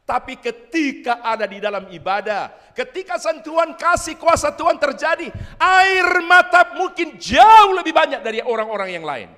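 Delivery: high, loud, impassioned preacher; preaching at full force. Real, not acted. (khotbah berapi-api, lantang)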